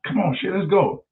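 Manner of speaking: high, urgent let's go; hurried escape call. Retold or imitated. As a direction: bolt and drag your friend out.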